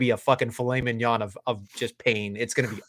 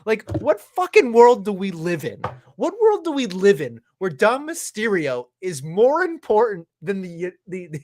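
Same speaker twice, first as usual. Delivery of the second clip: higher, louder, slow outraged rant; angry rant; 6 semitones higher, 4 dB louder than usual. Real, not acted. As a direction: drag out every word in disbelief. Ranting outrage about Dominic booking.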